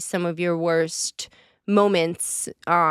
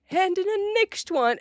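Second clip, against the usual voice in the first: higher voice copying the British coach; high mock copy; 11 semitones higher than usual. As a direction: echo the coach much higher, playfully. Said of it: "and you're like".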